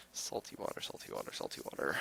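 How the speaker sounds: hushed, breathless whisper. Pretend. Whispered repeat of the item.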